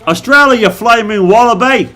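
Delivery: high, loud hammed-up Australian; mock Australian accent. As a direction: holler it like a caricature Australian. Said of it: "If you put Australian flag".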